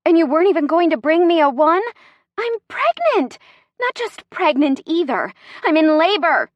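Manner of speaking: high, loud indignant protest; acted outrage, pitch jumping up; outraged. A narrator's reading. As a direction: complain indignantly, punching it out. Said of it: "Chrissy's voice is outraged".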